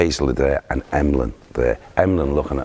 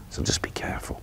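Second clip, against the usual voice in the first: dropped to a whisper for the warning; hushed warning; 11 dB quieter than usual. Retold or imitated. Player whispering a warning to Emlyn about the road, hushed. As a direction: whisper it like a secret caution.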